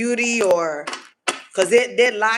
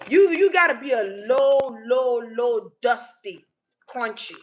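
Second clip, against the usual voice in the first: ordinary delivery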